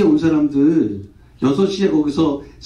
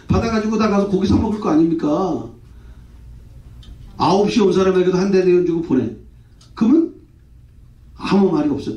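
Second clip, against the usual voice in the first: ordinary delivery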